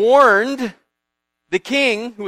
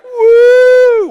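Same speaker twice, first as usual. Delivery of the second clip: very high, loud whoop of joy; on cloud nine whoop; gleeful whoop; 16 dB louder than usual. Retold or imitated. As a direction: Haman cheering as he leaves. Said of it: "He's just like".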